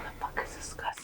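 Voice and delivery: hushed, puzzled whisper. Real, not acted. Whispering to her guest, puzzled about the character. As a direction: breathe it out under your breath, baffled.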